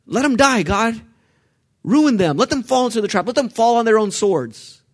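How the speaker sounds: high, loud impassioned psalm prayer building to a shout; preaching at full force. Acted. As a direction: pray it like a wronged man demanding justice. Continues the raging prayer.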